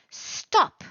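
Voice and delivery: sharp, high, loud "Stop."; warning shout. Shown as a demonstration. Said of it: "warning someone to stop".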